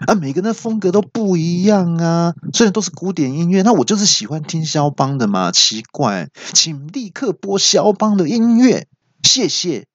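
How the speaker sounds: high, indignant lecturing; mock-angry high retort. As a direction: insist it matters. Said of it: "很生气的回说".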